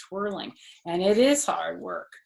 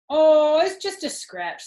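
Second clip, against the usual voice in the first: high, loud brush-off; mock-brave shrug; 8 semitones higher, 9 dB louder than usual. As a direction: play it cool to look tough. Fox now acting brave.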